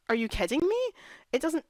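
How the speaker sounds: high, disbelieving outburst; real annoyance breaking out; exasperated. Real, not acted. Fan ranting about the finale, exasperated and disbelieving. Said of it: "I'm getting so angry".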